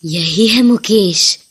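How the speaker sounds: very low, slow demonic voice; possessed voice. Acted. Possessed daughter reveals the ghost to her mother, menacing.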